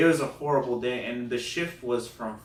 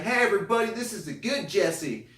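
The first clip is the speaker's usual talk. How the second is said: Southern host announcing; 10 semitones higher, 4 dB louder than usual. Retold or imitated. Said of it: "Real Southern accent".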